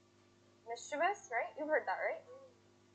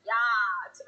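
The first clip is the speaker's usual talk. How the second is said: exaggerated yacht; 8 dB louder than usual. Shown as a demonstration. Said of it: "where's the A?".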